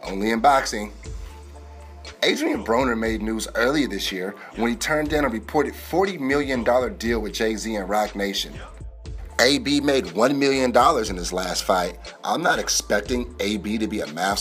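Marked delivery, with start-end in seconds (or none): none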